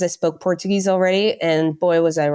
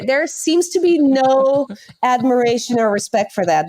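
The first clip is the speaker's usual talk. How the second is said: ordinary talk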